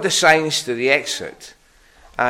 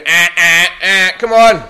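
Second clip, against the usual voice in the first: loud, impatient Aberdonian grumble; impatient driver; 4 semitones higher, 9 dB louder than usual. Retold or imitated. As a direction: hurry him up, annoyed. Queue of drivers behind him.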